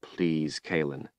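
hushed pleading thought; silent begging. A narrator's reading. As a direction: beg her under your breath. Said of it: "begged silently in his mind".

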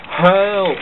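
high, loud cry for help. Acted. James crashing downhill, crying out high and loud. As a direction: call out in alarm. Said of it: "cried James".

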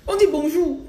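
high, loud mock Gabonese scolding; playful Gabonese French reproach; Gabonese accent. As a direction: correct them in a put-on Gabonese accent. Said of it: "ils parlent comme les Gabonais".

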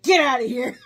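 loud, high shout of get out; angry shout. Retold or imitated. Grinch yelling at Max on the drums, loud angry shout. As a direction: drive the dog off with a yell. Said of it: "he's like".

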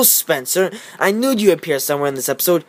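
normal talking voice

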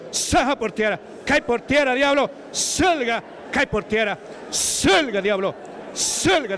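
high, driving repeated commands; exorcism command. Real, not acted. Preacher ordering the devil out of a woman, forceful exorcism. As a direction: cast it out with forceful high-pitched bursts.

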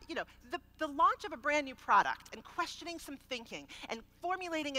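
harsh defensive outburst, pushed up and loud; defensive inner rant. Retold or imitated. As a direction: let the wounded pride out. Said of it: "it went something like this, in my head".

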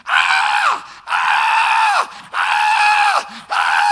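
long high falling screams; piercing terrified screams; eaglet screams. Acted. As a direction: scream like a baby bird tumbling.

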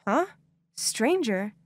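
usual speaking voice

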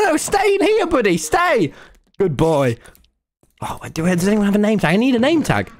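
normal talking voice

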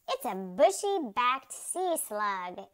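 loud, lively exclamation; exclaiming. A narrator's reading. Second attendant names the creature, exclaiming. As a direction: announce it with mild amazement, punching the words. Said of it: "exclaimed the other".

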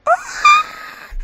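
very high, loud squeal; delighted shriek. Real, not acted. Gamer overwhelmed by cute toddlers, delighted shriek.

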